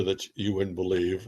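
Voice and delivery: ordinary delivery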